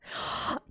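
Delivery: sharp unvoiced gasp; shocked gasp. Real, not acted. Player catches Tyler misbehaving in the game, shocked gasp.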